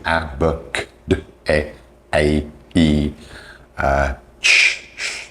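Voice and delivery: each sound alone with small gaps; isolated speech sounds. Shown as a demonstration. Teacher modelling the sounds of English, isolated phonemes. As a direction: list bare phonemes, one at a time. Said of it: "like the sounds of the language".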